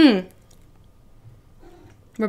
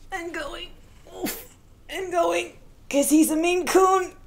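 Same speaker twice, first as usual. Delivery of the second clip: straining under weight; 10 semitones higher, slower than usual. Real, not acted. Lifting the heavy cat.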